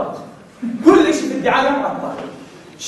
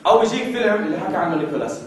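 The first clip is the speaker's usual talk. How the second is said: ordinary talk, nothing marked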